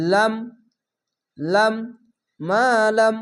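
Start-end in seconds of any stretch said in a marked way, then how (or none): none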